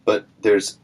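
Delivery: usual speaking voice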